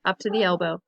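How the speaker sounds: ordinary delivery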